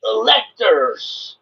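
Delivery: high, slow mocking echo; mocking echo of the guest; sarcastic mock. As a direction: drag it out sarcastically. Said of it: "sarcastic mocking of guest".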